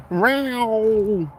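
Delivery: high, whiny mock cat cry; kitty meowing. Farmer imitates the dying barn cat, a high yowl. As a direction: yowl it like a hurt kitten. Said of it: "the kitty was all like".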